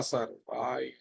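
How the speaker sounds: ordinary talk, nothing marked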